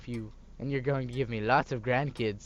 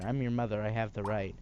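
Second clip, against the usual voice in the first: ordinary talk, nothing marked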